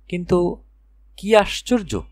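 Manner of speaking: ordinary delivery, nothing marked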